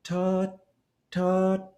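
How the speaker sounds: "ta" held high and level, cut off by the tongue; tongue-stopped demo. Shown as a demonstration. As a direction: say "ta" as a steady high note, stopped cleanly with a T. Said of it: "So you actually just go".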